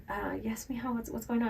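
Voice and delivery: soft youth leader. Retold or imitated. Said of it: "she was just like".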